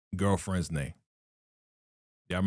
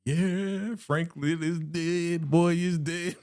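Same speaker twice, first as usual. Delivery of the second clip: higher, slow, gloating cheer; mock celebrating; 5 semitones higher, much slower than usual. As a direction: crow over the news in a put-on voice. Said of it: "she starts celebrating".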